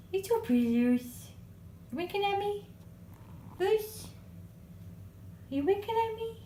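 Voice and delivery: high, sing-song cooing at the dog. Real, not acted. Owner cooing to her dog Luce, high baby talk. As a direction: coo at her like a puppy.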